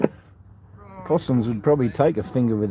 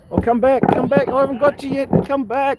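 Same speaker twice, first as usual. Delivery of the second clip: higher, eager coaxing call; calling the bird back; 12 semitones higher, 3 dB louder than usual. Real, not acted. Calling after the magpie to come back and be filmed.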